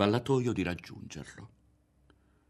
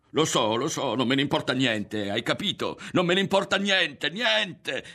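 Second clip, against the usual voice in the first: high, loud outburst, climbing to the end; barely contained yell; 8 semitones higher, 6 dB louder than usual. A narrator's reading. Ario refusing to hear Berto, near yelling. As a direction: build as if fighting not to scream. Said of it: "si tratteneva dall'urlare".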